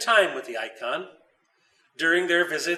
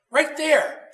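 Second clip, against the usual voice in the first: put-on eager child; 11 semitones higher, 6 dB louder than usual. A narrator's reading. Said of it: "pointed Leo".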